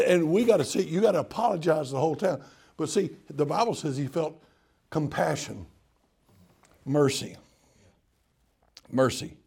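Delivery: ordinary talk, nothing marked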